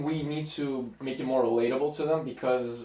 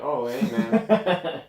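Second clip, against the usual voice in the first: awkward greeting; much slower than usual. Retold or imitated. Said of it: "I'm like".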